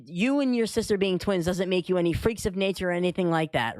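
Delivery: ordinary delivery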